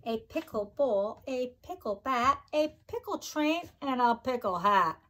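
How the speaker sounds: usual speaking voice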